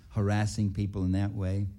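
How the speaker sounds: normal talking voice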